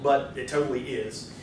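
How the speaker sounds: usual speaking voice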